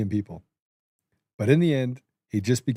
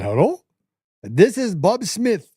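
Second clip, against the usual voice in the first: mock Indian scam caller; 4 semitones higher than usual. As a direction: mimic a call-centre scammer, only half committed. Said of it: "Bob Smith from India called me".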